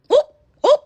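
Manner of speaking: high loud mock hoots. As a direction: hoot like a monkey to mock. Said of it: "mocking chant". (ઊંચા મોટા અવાજે ચીડવતી હુપ હુપ)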